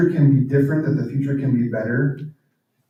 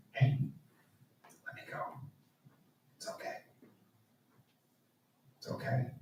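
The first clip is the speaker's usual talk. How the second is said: gentle whisper; 19 dB quieter, faster than usual. Retold or imitated. Old self gently releasing you.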